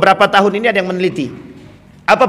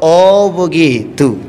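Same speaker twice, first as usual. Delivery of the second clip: slow understanding; 6 semitones lower, much slower than usual. Retold or imitated. Umar accepts the answer.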